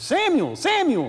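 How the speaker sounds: very high, loud summons; calling out. Acted. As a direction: call the name out high and loud, letting it fall. The voice calls Samuel a second time.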